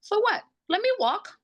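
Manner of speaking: high, incredulous retort. Retold or imitated. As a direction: toss it back, way up in disbelief. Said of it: "I'm like".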